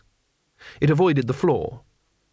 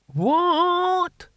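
very high, drawn-out "what?"; querying old warrior. A narrator's reading. Mad Hamish queries his companion's question, high querulous squawk.

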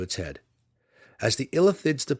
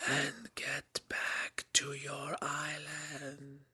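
distant unvoiced whisper, slow; a far-off voice inside the head; whispery telepathic voice; 5 semitones higher, 13 dB quieter, much slower than usual. A narrator's reading. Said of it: "the telepathic voice cried again".

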